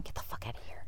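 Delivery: unvoiced muttered aside; under the breath. Retail worker cursing a customer under her breath. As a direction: breathe it out so the customer can't hear. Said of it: "under your breath going".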